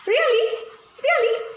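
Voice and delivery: high, eager double question; excited disbelief. Acted. Alan hearing he gets his teeth back, excited high voice. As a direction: jump at the news with hope.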